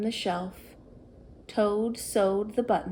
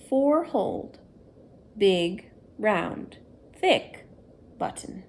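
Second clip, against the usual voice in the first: plain reading voice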